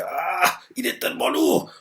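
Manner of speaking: straining effort. Acted. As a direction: voice him hauling a heavy body. Character strains to pull Gigi.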